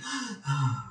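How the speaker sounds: audible breath in and out; acted sigh of breathing; demonstrated first breath. Shown as a demonstration. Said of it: "You start breathing".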